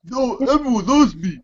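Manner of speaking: high hammed-up villain boast; mock Bray Wyatt boasting, pitched high. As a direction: preen as the creepy wrestler. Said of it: "I'm Bray Wyatt".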